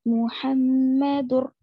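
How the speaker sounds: slow drawn मुहम्मदुर, joined straight on to the next word; मुहम्मदुर in careful Quranic reading; Tajweed recitation. Shown as a demonstration. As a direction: recite मुहम्मदुर slowly without nasal hum. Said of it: "सिर्फ मिलाना है आपको".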